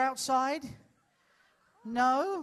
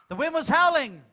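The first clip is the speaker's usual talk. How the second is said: louder, higher storyteller voice; dramatic story narration; 3 dB louder than usual. Acted. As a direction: set the scene dramatically.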